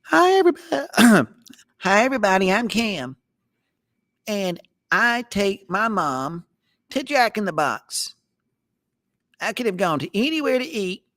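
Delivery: very high, squeaky mock kid voice; mock boy voice. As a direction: squeak it like a silly little boy, slowing down. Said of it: "if you do it in his boy- voice".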